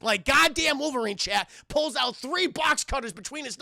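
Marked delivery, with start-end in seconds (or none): none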